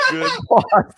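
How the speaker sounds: breaking into a laugh mid-phrase; high, laughing repeat of the words. Real, not acted. Cracking up while repeating his joke to his cohost.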